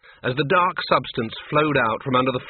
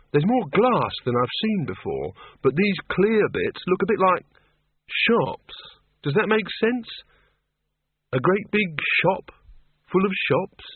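lightly voiced higher character, wondering; Ludmilla, puzzled; 6 semitones higher than usual. A narrator's reading. Ludmilla describing what she sees to the others, puzzled. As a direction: raise the pitch a little, thinking it out haltingly.

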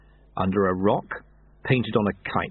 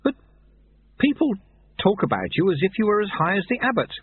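put-on higher, earnest young monk; a hint of a flustered boy; eager young novice; 10 semitones higher than usual. A narrator's reading. Novice protests, voice climbs.

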